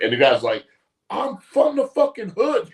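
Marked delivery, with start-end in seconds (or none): 1.1-2.7 high, loud, drawn-out punchline shout; imitated actor's high-pitched outburst; mock outraged yell. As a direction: yell it slowly in comic protest.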